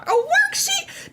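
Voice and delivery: very high, slow incredulous cry; high drawn-out disbelief. Real, not acted. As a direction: stretch it out in disbelief. Incredulous at the worksheet.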